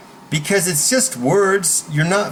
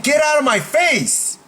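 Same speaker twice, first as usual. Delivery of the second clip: much higher, forceful order; meant command; 11 semitones higher than usual. Shown as a demonstration. Coach shows how to mean it, high forceful command. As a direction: say it like you mean it, pitched high. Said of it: "Say it like you mean it".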